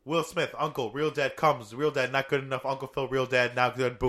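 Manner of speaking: loud telegram-style recap, each word stressed; quick and emphatic. Shown as a demonstration. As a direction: punch every word hard, loud and even, up to "boom".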